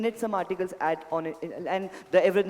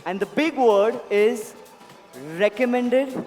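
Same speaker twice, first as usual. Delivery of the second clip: dramatic reveal; 3 semitones higher, 5 dB louder, slower than usual. Real, not acted.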